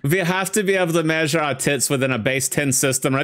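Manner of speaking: hammed-up fake German accent, not even German; terrible German accent. Joking about cup sizes over a movie, in a bad mock German accent. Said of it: "that's a terrible German accent".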